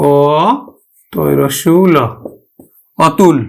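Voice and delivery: অতুল letter by letter, then whole, "aw-tul"; spelled out. Shown as a demonstration. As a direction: spell অতুল with pauses, first vowel open. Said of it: "উচ্চারণ করি কিন্তু". (অতুল বানান করে বিবৃত অ দিয়ে উচ্চারণ)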